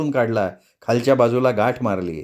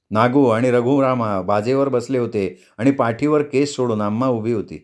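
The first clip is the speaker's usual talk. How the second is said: ordinary delivery, nothing marked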